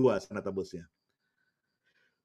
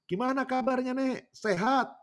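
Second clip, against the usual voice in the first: very high, drawn-out hailing call; imitated pastor's high-pitched holler; calling from afar; 14 semitones higher, slower than usual. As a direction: greet an old granny from far off, stretching the words. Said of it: "gembalanya langsung teriak. Dari jauh udah teriak".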